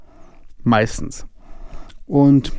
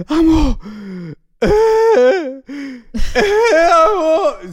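high, loud mock sobs between gasps; imitated tearful gasping; 3 dB louder than usual. Girlfriend phones him unable to speak, imitated breathless crying. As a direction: imitate someone crying too hard to get words out. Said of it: "die am Weinen ist und keine Luft kriegt".

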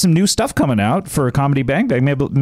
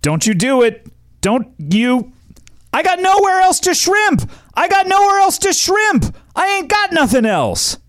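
melodramatic high delivery. Acted.

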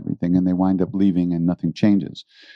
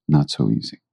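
repeated lower, to itself; "Not so easy" murmured lower as an afterthought; lower rueful echo; 5 semitones lower than usual. Shown as a demonstration. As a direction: drop the pitch and echo it ruefully.

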